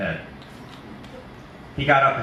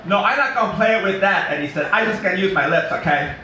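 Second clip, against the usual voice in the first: high, loud big simple man; simple Eddie; 9 semitones higher, 5 dB louder than usual. Acted. Narrator voicing simple Eddie to the kids, high and loud. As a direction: boom it out like an eager childlike giant. Eddie answering Art's taunt.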